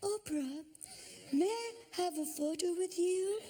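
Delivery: high, sweet, innocent choirboy plea; little choir boy voice. Retold or imitated. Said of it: "in this little choir boy voice".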